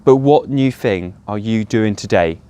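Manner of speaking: usual speaking voice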